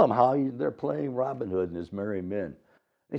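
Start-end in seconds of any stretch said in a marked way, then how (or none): none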